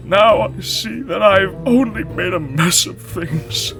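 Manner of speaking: a sorry lion asking for friendship; crying lion. A narrator's reading. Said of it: "began to cry more profusely".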